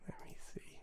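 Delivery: ordinary talk, nothing marked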